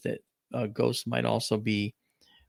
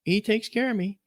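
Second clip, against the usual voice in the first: grandmother's voice; 9 semitones higher than usual. Retold or imitated. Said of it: "She goes".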